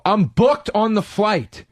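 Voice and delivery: high, drawn-out protest; indignant insistence. Retold or imitated. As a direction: stretch each word in indignation. Arguing with the gate agent.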